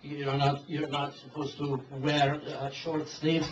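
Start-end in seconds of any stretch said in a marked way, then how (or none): none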